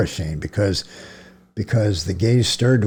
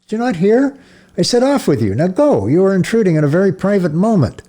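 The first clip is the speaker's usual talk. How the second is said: angry shout; 10 semitones higher, 4 dB louder than usual. A narrator's reading. Priest yelling at unmoving child.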